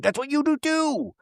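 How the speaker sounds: imitating a bickering housewife, high-pitched; mock comeback. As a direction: replay the petty retort in a small high voice. Repeats the mocked comeback.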